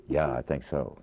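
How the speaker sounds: low-key film-star mumble; low movie-star talk. Retold or imitated. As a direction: imitate a movie star's low, underplayed voice. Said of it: "and then they talk like that".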